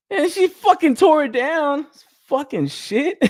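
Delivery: high, drawn-out comic outrage; exasperated outcry. Real, not acted. Griping that mom tore it down, exasperated outcry. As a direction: wail it slowly, about to laugh. Outraged retelling of posters torn down.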